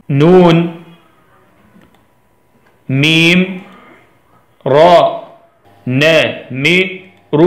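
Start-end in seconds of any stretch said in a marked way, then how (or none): 5.9-7.4 "نمر" very slowly as na, mi, ru; split into syllables. Shown as a demonstration. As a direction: leave a gap after each syllable of "نمر". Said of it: "نفكر مع بعض".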